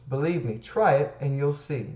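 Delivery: normal talking voice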